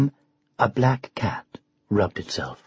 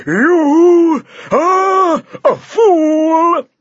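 slow, loud, much higher furious cry; raging; 14 semitones higher, 7 dB louder, much slower than usual. Acted. The champion rages at the upstart knight. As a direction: bellow the insult in fury. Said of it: "fairly gobbled with rage".

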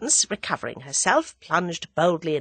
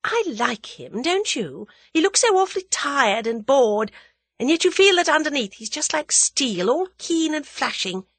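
reflective; 9 semitones higher than usual. A narrator's reading. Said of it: "she added reflectively".

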